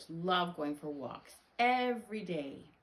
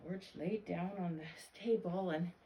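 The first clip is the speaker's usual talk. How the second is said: ordinary delivery, nothing marked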